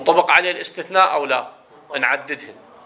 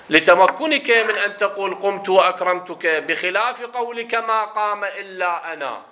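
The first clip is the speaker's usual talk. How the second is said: formal text reading at a raised, sustained pitch; reading aloud; 5 semitones higher than usual. Acted. Teacher reading examples from the text, high formal reading voice. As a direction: read it out higher, in careful classical Arabic.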